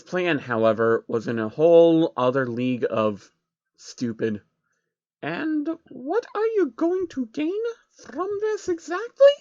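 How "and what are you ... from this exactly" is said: much higher, slow, careful pony voice; asked cautiously. A narrator's reading. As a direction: ease out the question warily. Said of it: "she asked cautiously".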